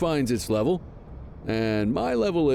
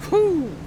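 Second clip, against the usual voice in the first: relieved whew; 14 semitones higher, 5 dB louder than usual. Acted. Relief after the pursuit.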